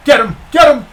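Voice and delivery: shouted urging at the screen; shouting. Real, not acted. Gamer yelling at his pitcher. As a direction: yell it at the pitcher to finish him.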